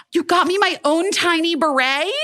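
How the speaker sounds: rising to a high gasp of delight; thrilled birthday surprise, replayed; mock excited. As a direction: build from disbelief to a squeal. Surprised by birthday beret gift.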